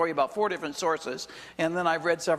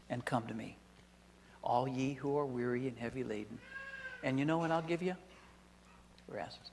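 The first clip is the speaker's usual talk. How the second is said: growing softer and lower down to a hush on Rest; fading invitation; 3 semitones lower, 6 dB quieter than usual. Acted. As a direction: draw them in, quieter with each line.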